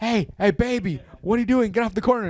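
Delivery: high, mock-stern call to a kid on the corner; hey baby, get off the corner, called up high. Dave Chappelle bit, calling to kid.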